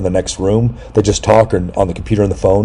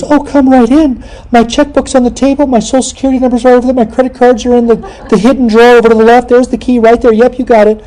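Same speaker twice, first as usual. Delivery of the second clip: high falsetto, eagerly welcoming; mock gullible woman; 13 semitones higher than usual. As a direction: parody an over-trusting lady, pitched way up. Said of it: "And she goes".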